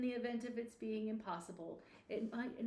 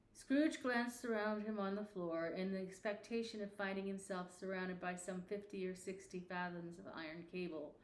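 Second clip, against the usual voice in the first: plain reading voice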